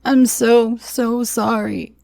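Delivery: high, slow, broken sobbing; begging forgiveness while weeping; sobbing apology. Acted. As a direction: drag the sorry out through sobs. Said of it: "he cried".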